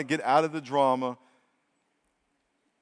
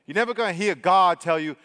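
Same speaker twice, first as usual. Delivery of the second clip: usual speaking voice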